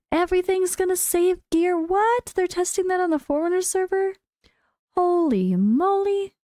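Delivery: high, amazed, tumbling out; can't believe the good news; excited. Real, not acted. Thrilled reading gear-save change.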